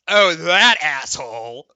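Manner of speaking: loud, slow, scornful outburst; eye-rolling disgust. Acted. Christy rolls her eyes at the mention of Steve, loud and scornful. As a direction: spit out the name of a rival you despise. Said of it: "rolls her eyes and goes".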